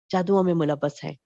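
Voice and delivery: usual speaking voice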